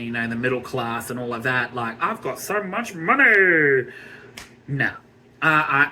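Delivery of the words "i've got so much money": lampoon a smug rich lady bragging; mock rich woman. Mocking rich women on TV.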